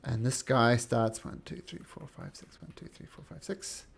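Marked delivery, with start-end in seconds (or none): 1.2-3.9 muttered counting. Real, not acted. GM counting under breath.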